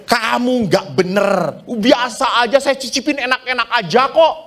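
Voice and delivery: mock indignant wife; insisting wife. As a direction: brush off his complaint. Wife insisting food fine.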